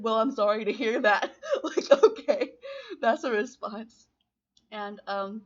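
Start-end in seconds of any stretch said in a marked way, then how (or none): 0.0-1.3 laughing through it. Real, not acted. Retelling her stunned reply to the confession. 1.5-4.1 laughing. Real, not acted. Laughing at her own reply.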